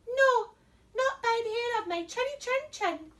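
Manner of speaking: high, squeaky little pigs; high pig cry. Acted. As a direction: squeal it back. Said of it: "cried the little pigs".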